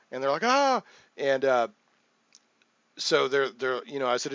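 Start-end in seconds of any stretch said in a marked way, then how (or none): 0.4-0.8 high, loud mock "ah!"; alarmed cry. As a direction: imitate a startled group crying out. Imitates party's alarmed reaction.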